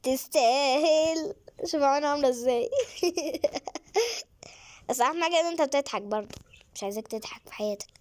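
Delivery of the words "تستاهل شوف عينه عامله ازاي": high, loud, laughing through the words. Real, not acted. Laughing at dizzy baby. (بتضحك بصوت عالي)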